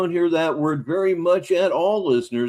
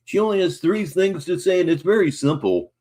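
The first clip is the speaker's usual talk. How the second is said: ordinary delivery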